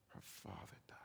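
breathy unvoiced whisper. Preacher retelling the whispered news of her father, in a hush.

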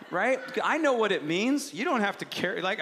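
normal talking voice

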